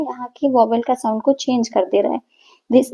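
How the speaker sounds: usual speaking voice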